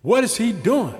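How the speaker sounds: loud, scornful, fast complaint; mocking scorn, then a loud high outburst. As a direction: scoff at the chosen leader, rising loud at the end. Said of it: "they be like".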